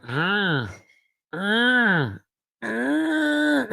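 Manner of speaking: struggling bug noises climbing to a squeak; mosquito straining cry. Retold or imitated. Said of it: "It did one of these moves where it's like".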